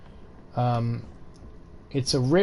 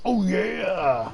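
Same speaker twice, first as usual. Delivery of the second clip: drawn-out, pitched-up mascot shout; hammed-up triumphant catchphrase; Mister Kool-Aid; 5 semitones higher than usual. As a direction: bellow it like a mascot bursting through a wall. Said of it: "He breaks through like Mister Kool-Aid".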